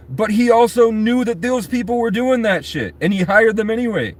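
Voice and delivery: high-pitched, hammed-up indignant grilling; acted confrontation. Senator confronts official over Epstein files, imitated indignation.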